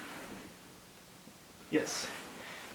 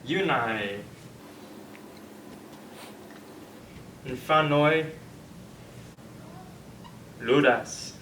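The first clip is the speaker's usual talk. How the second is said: each word read slowly, spaced apart; slow careful reading; 5 dB quieter, slower than usual. Shown as a demonstration. Teacher reading a sentence to students, slow and spaced out. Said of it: "Final sentence of the day".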